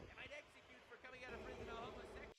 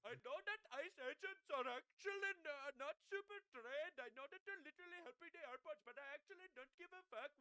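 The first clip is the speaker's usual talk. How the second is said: higher, louder, slowed sneering impression of a leftist; mocking leftist voice; 5 semitones higher, 6 dB louder than usual. Streamer mocking a leftist's view on ICE, sneering mock voice. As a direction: ham up a whiny activist you despise.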